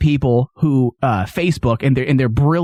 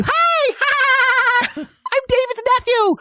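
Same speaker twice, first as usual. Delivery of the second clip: put-on much higher, giggling little boy introducing himself; giggly nephew voice; slower than usual. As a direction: play the cackling kid, way up high. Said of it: "Is that what your nephew sounded like?".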